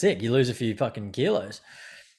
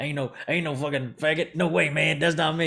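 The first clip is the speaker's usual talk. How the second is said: lightly imitated movie character, pitched up; higher-voiced, half-acted film denial; Ron Woodruff; 4 semitones higher than usual. Patient likening himself to Ron Woodruff, mock denial. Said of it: "I was Ron Woodruff".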